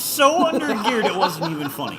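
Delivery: high, loud protest breaking into laughter; loud excuse-making. Real, not acted. Teased racer blames the car, loudly exasperated. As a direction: plead your case loudly, high-pitched.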